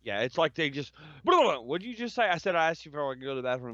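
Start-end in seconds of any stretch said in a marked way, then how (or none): 1.2-3.7 mock rapid-fire babble; rushed, gabbled impression of New Yorkers; sped-up New Yorker talk. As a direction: rattle it off as if on speed. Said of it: "talks like they're fucking on steroids or speed".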